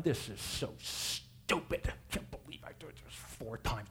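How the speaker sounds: whispered muttering under his breath; slow, whispered complaint to himself; sulky general mutters in the muddy river; grumbling. Acted. Naaman grumbling while dunking in river.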